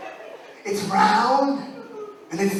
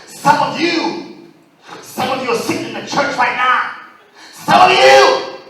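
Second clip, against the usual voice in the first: slow, loud, rising sermon; preaching; 5 semitones higher, 4 dB louder, slower than usual. Pretend. Comic preaching to the audience, slow and loud. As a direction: build it like a preacher at the pulpit. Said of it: "I'm gonna preach it right now".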